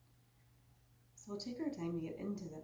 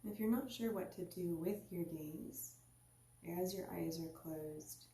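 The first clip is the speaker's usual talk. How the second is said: guided meditation. Acted. Teacher guiding listeners into their seat, calm meditation voice. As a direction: ease the listener inward, unhurried.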